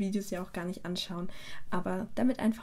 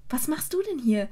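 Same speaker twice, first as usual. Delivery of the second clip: higher-pitched, delighted question; happy surprise; 6 semitones higher than usual. A narrator's reading. Hermine happily greets Hagrid as she hugs him. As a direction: greet an old friend happily. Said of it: "fragte Hermine glücklich".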